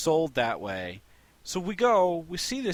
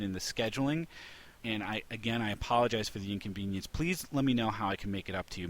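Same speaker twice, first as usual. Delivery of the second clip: polite apology; 5 semitones lower, 5 dB quieter than usual. Shown as a demonstration. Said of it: "all she would have needed to do was this".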